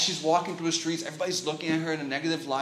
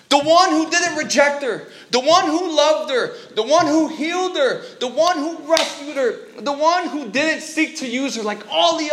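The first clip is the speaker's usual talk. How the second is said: fiery sermon climax; preaching at full force; 11 semitones higher, 8 dB louder than usual. Acted. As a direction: build the crowd up, proclaiming. Preaching climax about Jesus.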